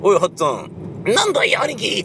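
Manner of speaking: over-the-top voice change between two characters; exaggerated character voices. Shown as a demonstration. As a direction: switch to a very high cartoonish voice for the reply. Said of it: "とかっていうようなことは絶対やらないでしょ".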